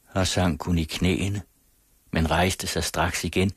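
ordinary delivery, nothing marked

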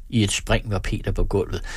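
ordinary delivery

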